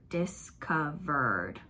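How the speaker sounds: discovered said very slowly, each syllable drawn out; slow teaching demo of "discovered"; stretched out slowly. Shown as a demonstration. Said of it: "If you stretch out that part".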